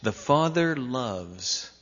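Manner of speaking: normal talking voice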